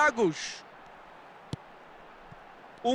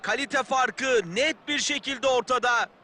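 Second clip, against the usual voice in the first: ordinary delivery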